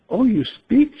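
startled cry. Acted. Ingeborg shocked the bird answers, high and loud. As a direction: Ingeborg amazed the bird can talk. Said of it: "she says".

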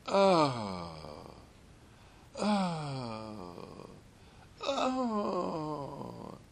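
contented moans. Retold or imitated. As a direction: sigh out pleasure, a touch crying. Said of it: "He said".

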